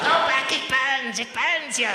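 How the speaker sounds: high, slow yelp of pain. Retold or imitated. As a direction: yelp like the sand scorches. Sand burning feet on beach.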